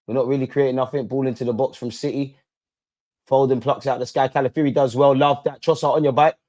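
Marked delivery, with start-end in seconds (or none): none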